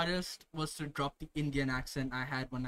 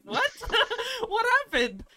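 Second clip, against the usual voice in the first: much higher, louder mock surprise; mock bewilderment; 3 dB louder than usual. Brother baffled by coworkers' sudden accent, high mock surprise. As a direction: squeal it in laughing disbelief. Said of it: "and you're like".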